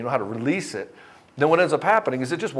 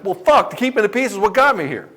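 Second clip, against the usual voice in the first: higher and louder, fed up; frustrated client; 7 semitones higher, 5 dB louder than usual. Retold or imitated. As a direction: hurl it back at the counselor. Client snapping at counselor.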